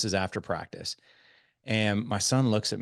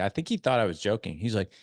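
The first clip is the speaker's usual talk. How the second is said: ordinary delivery, nothing marked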